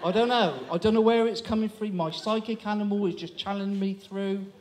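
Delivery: ordinary talk, nothing marked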